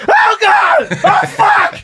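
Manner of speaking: very high-pitched screamed exclamations; reenacted scream. Fan reliving Julio's back-to-back homer, screaming. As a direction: scream in disbelief at a second homer. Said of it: "so I make that loud noise".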